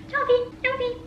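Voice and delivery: high, soft cooing to the cat; pet talk. Real, not acted. Owner coaxing her cat to eat, high cooing. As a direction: coax the pet gently.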